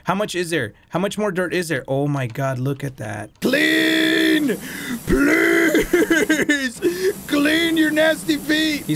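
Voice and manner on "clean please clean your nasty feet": desperate yell. Real, not acted. Player yelling at the dinosaur's feet to get clean, desperate. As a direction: beg it at full voice. Begging the dirt to come off.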